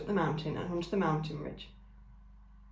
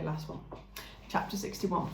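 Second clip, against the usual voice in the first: usual speaking voice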